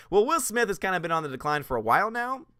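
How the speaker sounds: normal talking voice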